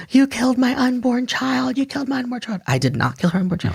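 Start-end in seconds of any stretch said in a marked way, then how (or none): 0.1-2.6 high, shrill mock scream of a pregnant woman; retold screaming woman. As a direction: imitate her hysterical accusation, pitched way up. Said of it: "She's screaming".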